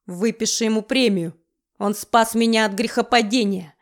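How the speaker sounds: usual speaking voice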